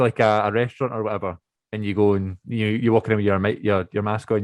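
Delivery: normal talking voice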